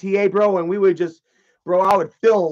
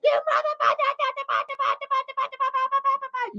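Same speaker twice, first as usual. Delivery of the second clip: very high squeaky babbling imitation; hammed-up hysterical woman, repeating like a stuck record; crazed rant; 3 dB quieter, faster than usual. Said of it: "she went crazy".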